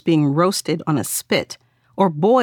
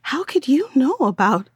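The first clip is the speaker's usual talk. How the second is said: stammering, higher voice; 7 semitones higher than usual. Acted. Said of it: "she stammered".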